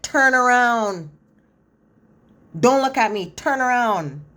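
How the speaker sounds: slow, high, loud firm orders; Mallory ordering him to turn around, slow and stern; stern command. Retold or imitated. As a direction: lay down the law, word by word. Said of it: "Mallory said".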